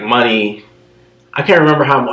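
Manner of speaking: ordinary talk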